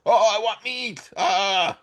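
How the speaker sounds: high, hammed-up craving cry; adamant, enthusiastic. Teacher acts out the old rabbi demanding dinner, hammed-up enthusiasm. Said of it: "He adamantly replied".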